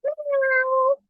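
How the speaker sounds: high meow; cat meow imitation. Shown as a demonstration. Showing kids how a cat sounds, meowing. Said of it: "Kucing kan suaranya gimana?".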